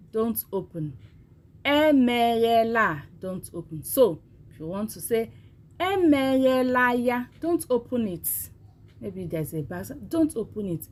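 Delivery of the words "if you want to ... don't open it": ordinary talk, nothing marked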